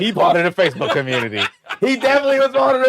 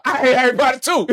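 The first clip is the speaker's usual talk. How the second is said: raised, pitched-up comic shout; mock yell; 4 semitones higher, 4 dB louder than usual. Eavesdropper busting the door open, mock yell. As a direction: burst in yelling.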